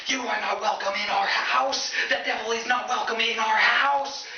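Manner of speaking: high, drawn-out outraged yell; hammed-up high-pitched screaming; screaming parents. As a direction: scream it across the doorstep as a horrified parent. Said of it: "her parents open and instantly start screaming".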